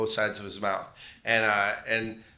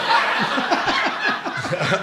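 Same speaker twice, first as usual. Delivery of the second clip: laugh; 13 semitones higher, 4 dB louder than usual. Real, not acted. Laughs at own joke.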